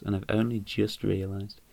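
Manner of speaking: ordinary talk, nothing marked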